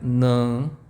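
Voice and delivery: nan said through the nose, a closed nasal ah. Shown as a demonstration. The teacher shows the nasal A in nan. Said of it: "they have a nasal sound".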